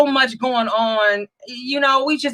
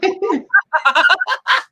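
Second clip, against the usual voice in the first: high, amused laugh; 6 semitones higher than usual. Real, not acted. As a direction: laugh at your own joke. Laugh after mocking friend.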